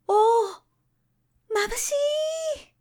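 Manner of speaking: very high, drawn-out crying shout, slow; dazzled cry. A narrator's reading. Newly opened buds cry out at the bright world, a long high shout. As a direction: cry out blinded by the first light. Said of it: "はじめは叫びました".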